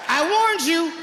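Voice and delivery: hammed-up warning before firing; shouted high and hard; mock warning shout. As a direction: yell it high as the trigger is pulled. Firing at intruder.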